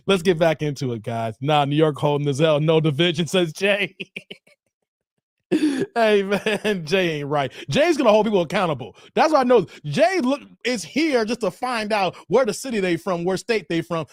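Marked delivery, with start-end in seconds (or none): none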